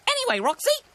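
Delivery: high, loud bird voice; high-pitched cartoon magpie; magpie character. A narrator's reading. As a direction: squawk it cheerily.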